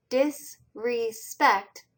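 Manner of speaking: "disrespect" split into dis, re, spect; teacher breaking "disrespect" at each syllable. Shown as a demonstration. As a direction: say "disrespect" slowly in three separate parts. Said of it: "three syllables or three parts of the word".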